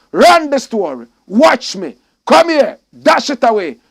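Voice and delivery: loud, high rapid-fire hype calls; hype shouting. Acted. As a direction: pump up the crowd with each line. Shouted catchphrases from comment.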